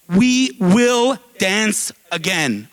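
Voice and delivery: very slow, word by word; deliberate, weighty rallying line; solemn vow. Real, not acted. Speaker closes by repeating words that are everything, solemn vow.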